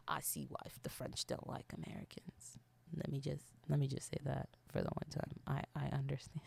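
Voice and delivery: low, near-whispered confession; very soft, low confidential murmur; hushed aside. Real, not acted. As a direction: drop right down as if confiding a secret. Confidential aside about the French.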